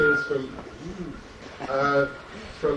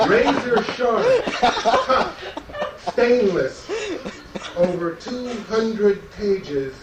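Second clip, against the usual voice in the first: louder, punchy hard-sell reading broken by laughter; mock ad pitch; 5 semitones higher, 4 dB louder than usual. Poet to audience, reading his magazine-ad poem like a laughing huckster. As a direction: ham it up like a back-of-magazine advert.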